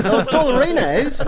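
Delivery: laughing, high mock-Australian; Australian accent. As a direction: play up an Aussie accent through laughter. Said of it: "I love doing the Australian accent".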